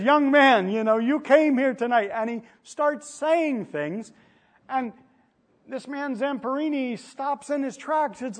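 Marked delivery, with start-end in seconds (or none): 0.0-2.1 louder and higher, ringing preacher's call; booming crusade preacher, louder and higher, no accent; evangelist's call. Retold or imitated. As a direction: call out to the young man like an evangelist from the pulpit. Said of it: "he says".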